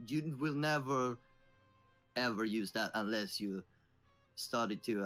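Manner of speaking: ordinary talk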